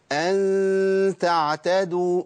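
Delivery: أن تعتدوا slow, nasal ghunna held long, lips in a slight smile; light nasal ghunna with a smile. Shown as a demonstration. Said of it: "تاني".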